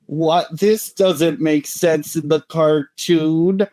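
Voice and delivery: mock whining, high and drawn out; put-on whine of baffled protest; whiny baby. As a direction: imitate a whiny fan complaining, slowly. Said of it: "whiny babies just being like".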